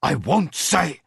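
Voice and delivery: stubborn and afraid. Acted. Prisoner refusing his captors, afraid but stubborn. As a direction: refuse through the fear. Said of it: "afraid but still stubborn".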